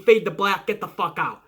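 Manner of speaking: usual speaking voice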